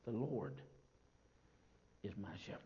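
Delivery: very soft, low "The Lord is my shepherd"; tender soft ending; hushed close. Acted. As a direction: say it like a quiet benediction. Quiet closing.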